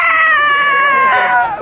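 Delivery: high loud sob-cry; loud anguished wail. Real, not acted. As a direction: let out a wail. Both crying.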